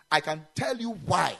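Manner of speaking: ordinary talk, nothing marked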